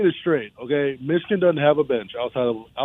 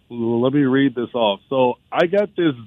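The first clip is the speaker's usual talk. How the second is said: usual speaking voice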